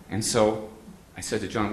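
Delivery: ordinary delivery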